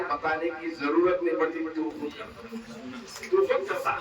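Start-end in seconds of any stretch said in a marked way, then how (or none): 1.7-3.3 slow and much softer, stretching each word; drawn-out hush. Real, not acted. Preacher softly drawing out the point to the congregation, slow and hushed. Preacher repeating phrase for effect. 3.3-3.9 much higher, voiceless, breathy repeat; high breathy echo. Real, not acted. Preacher echoing his point to the crowd, high and breathy.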